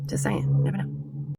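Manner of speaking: teasing the haters, whispered; whispered aside. Acted. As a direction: whisper it sly and low. Sly aside to viewers.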